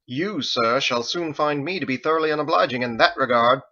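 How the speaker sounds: frowning, prim rebuke; haughty cleric scolding; stern disapproval. A narrator's reading. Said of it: "frowned at Harold".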